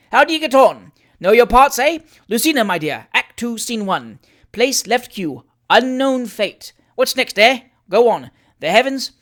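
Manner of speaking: loud, high, boisterous uncle; jovial old gentleman taking charge; hearty Uncle Tom. A narrator's reading.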